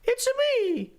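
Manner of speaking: very high squeaky Mario impression; high cartoon Mario voice. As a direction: pitch way up like the plumber. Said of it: "How do you do the voice?".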